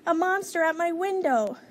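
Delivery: high-pitched cry of alarm; frightened shout. A narrator's reading. Baby raises the alarm from bed, high alarmed shout. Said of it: "shouted Baby".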